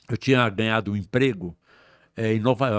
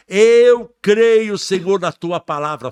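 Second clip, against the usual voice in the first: much louder and higher cry of faith; shouted declaration; 9 semitones higher, 11 dB louder, slower than usual. Retold or imitated. Son proclaiming faith the second time, louder shout. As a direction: shout it to the heavens. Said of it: "Falei a segunda vez".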